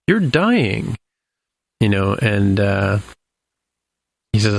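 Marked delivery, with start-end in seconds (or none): 0.1-1.0 retold revelation. Retold accusation as she turns on him.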